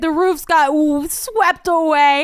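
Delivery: high, loud, slowed lament; dramatic distress. Retold or imitated. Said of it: "I was a mess on the phone".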